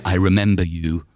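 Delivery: deadpan line reading; child actor line. Retold or imitated. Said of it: "I had the line".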